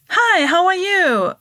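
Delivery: high, slow, sing-song polite greeting; British greeting. Retold or imitated. Danish comic imitating British people greeting her. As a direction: mimic a chirpy British hello. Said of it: "British people, of course, would be like".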